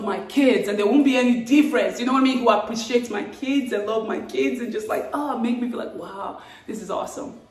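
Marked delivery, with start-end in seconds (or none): none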